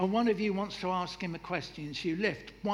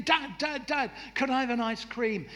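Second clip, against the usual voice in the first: put-on high, eager kid pleading for ice cream; a child rushing in, begging dad; 7 semitones higher, 3 dB louder than usual. Kid bursts into dad's study, eager and high.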